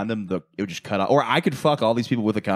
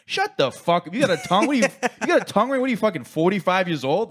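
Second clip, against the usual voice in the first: mock angry girl; 9 semitones higher than usual. Girl cursing out a teacher.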